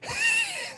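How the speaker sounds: short high laugh burst; quick laugh. Real, not acted. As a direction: chuckle at your own joke. Laughing at his own joke.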